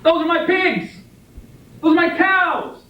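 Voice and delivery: high-pitched yell of a claim. Shown as a demonstration. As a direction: yell it to beat everyone else in the car. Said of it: "you yell out".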